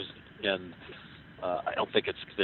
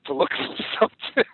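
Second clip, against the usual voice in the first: mock warning; 7 semitones higher, 6 dB louder than usual. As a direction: belt out a teasing warning to listeners. Mock warning to listeners, laughing.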